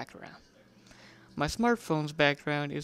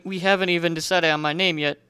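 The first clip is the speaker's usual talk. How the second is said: plain reading voice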